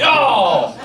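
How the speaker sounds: normal talking voice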